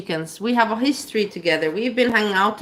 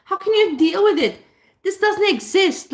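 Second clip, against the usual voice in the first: much higher, sing-song fake pity; mock gushing doctor; 9 semitones higher than usual. Parodying her claim that doctors feel sorry, much higher gushing voice. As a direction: overdo the shocked sympathy. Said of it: "They're like".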